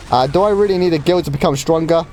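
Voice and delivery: ordinary delivery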